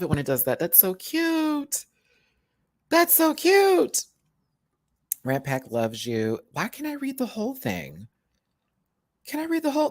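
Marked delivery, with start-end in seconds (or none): 0.6-4.0 high slow coo; cooing cute. Real, not acted. 6.5-9.9 high whiny complaint. Real, not acted. Frustrated with the app.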